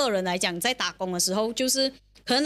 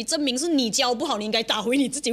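ordinary delivery

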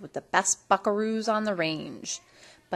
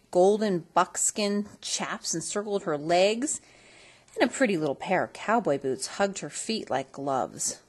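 ordinary delivery

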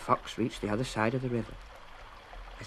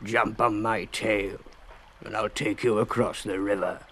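growled offer; 3 semitones lower than usual. A narrator's reading.

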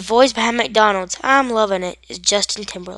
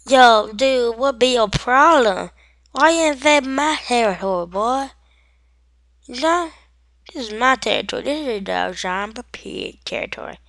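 higher, exaggerated tough-guy challenge; mock turf-war swagger, pitched up; street-talking zombie pig; 4 semitones higher, 4 dB louder than usual. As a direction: act a territorial pig talking slang. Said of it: "that Pippin be chill-, chilling out like".

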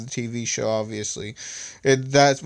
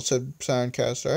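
ordinary delivery, nothing marked

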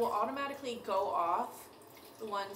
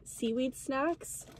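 ordinary talk

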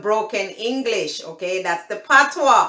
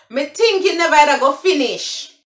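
loud Jamaican patois, higher; 6 semitones higher, 4 dB louder than usual. Shown as a demonstration. Showing viewers the patois version, in Jamaican patois. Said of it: "That's the broken English".

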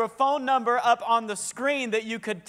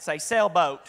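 southern accent; 4 semitones lower than usual. Shown as a demonstration. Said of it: "No, like me, like the southern accent".

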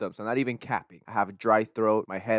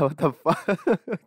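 laughing; 4 semitones higher, much slower than usual. Real, not acted. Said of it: "I don't know why I said that".

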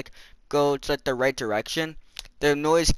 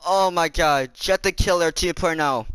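ordinary delivery

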